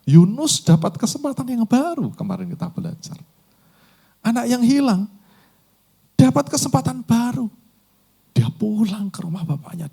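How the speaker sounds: high, loud urgent sermon exhortation; full-force preaching. Acted. Preacher building to a climax. (khotbah berapi-api, suara tinggi dan keras)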